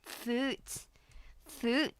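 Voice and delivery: "Foot" with a fronted Scottish oo. Shown as a demonstration. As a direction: say "Foot" with a Scottish oot sound. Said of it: "which actually turns foot into foot".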